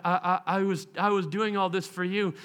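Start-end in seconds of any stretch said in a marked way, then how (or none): none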